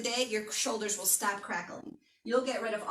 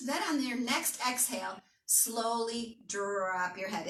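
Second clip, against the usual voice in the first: guided stretch; 2 semitones lower than usual. Acted. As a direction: ease them down into the stretch. Leads a slow stretch.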